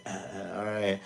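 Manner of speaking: two small mock coughs, then answering; imitated cough. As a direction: cough obediently for the doctor, half-hearted. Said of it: "and cough".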